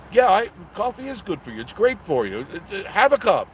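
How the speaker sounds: playful urging, voice jumping up; excited coffee pitch. Real, not acted. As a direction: sell the coffee with a grin.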